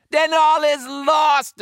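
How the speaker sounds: very high, loud cry of doom; hammed-up high-pitched despair; despairing wail. A narrator's reading. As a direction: wail it like a panicking pirate. Pirate despairs before the fight.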